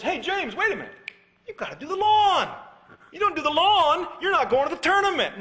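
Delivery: loud, high-pitched ultimatum shouted down the drive; angry dad yelling. Retold or imitated. Dad calling son back from the driveway, shouting high. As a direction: yell after the departing son.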